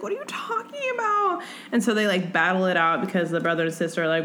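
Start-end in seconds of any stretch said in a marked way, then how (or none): 0.0-1.4 much higher, airy bewildered voice; mock confused heroine, much higher; high confused girl. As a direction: squeak out the confusion. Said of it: "And she's like".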